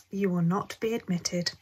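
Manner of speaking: usual speaking voice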